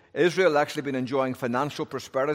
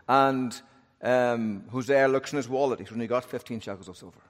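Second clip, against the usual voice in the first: ordinary delivery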